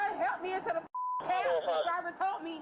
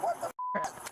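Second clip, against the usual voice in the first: 1 mock outraged what. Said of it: "So I'm like".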